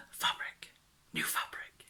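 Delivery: awed, hushed whisper; excited whisper. Acted. Vlogger shows her reaction to scrunching fabric, excited whisper. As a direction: gasp and whisper it.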